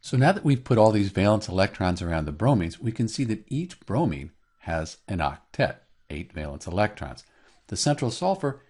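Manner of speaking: ordinary talk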